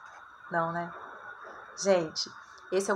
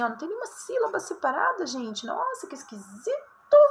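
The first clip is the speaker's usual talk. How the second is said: mock surprise; 7 semitones higher than usual. Acting a corrector's surprise.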